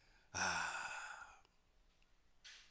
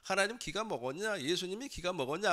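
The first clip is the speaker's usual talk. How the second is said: higher-pitched mock rebuke of a noisy man; retold scolding; 6 semitones higher than usual. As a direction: imitate annoyed bystanders telling someone off. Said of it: "아마 그러면서 꾸짖었을 거예요".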